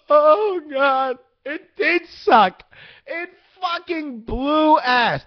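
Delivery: high, loud and slow, almost yelling; slowed-down outraged rant, half shouting; exasperated shout. Real, not acted. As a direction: vent it like you can't believe it. Ranting that Phantom Menace sucked.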